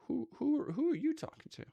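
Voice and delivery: can't believe who he's preaching to; incredulous. Real, not acted. Streamer reacting to the preacher's words, incredulous and high.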